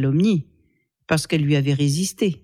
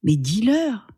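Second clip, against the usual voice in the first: ordinary delivery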